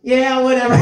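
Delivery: high, dismissive character voice; Ed brushing off. Acted. Acting Ed brushing off Al and Winry, high dismissive tone. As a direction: toss it off over your shoulder. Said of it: "And Ed goes".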